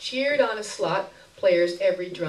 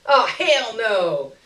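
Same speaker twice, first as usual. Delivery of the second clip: slow, louder, drawn-out refusal; refusing beggar woman; 3 semitones higher, 8 dB louder, much slower than usual. A narrator's reading. Beggar woman refusing the bag, slow emphatic refusal. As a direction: stretch out the no in disgust. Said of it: "she said, dropping the bag".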